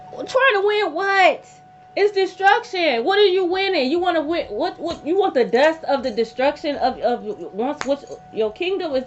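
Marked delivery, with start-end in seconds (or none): 0.1-5.4 indignant rant. Real, not acted. Reader scolding people who try to win, high and emphatic. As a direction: scold them for chasing a hollow win.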